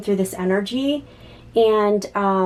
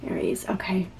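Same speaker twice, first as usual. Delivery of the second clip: whisper; 7 dB quieter than usual. Real, not acted. Asking Spirit over the cards.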